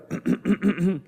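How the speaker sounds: exaggerated pig throat-clearing; long, rattling ahem. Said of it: "Clear your throat".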